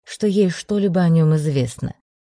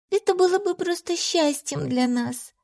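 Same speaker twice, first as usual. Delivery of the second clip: shy, hesitant plea; embarrassed; 12 semitones higher than usual. A narrator's reading. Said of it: "произнесла она смущённо".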